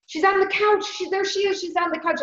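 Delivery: high-pitched imitation of a shaken old man; wobbly, delusional uncle. Grieving uncle on the phone insists he sees his wife, high and wobbly imitation. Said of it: "His voice was wobbly".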